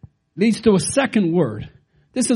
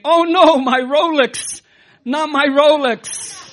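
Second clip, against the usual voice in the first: loud, slow mock wail over the watch; hammed-up cry of loss; exclaiming; 8 semitones higher, 5 dB louder than usual. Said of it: "which the man exclaimed".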